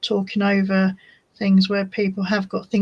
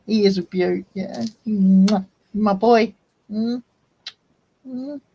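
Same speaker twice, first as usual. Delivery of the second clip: normal talking voice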